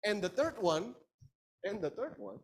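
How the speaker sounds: normal talking voice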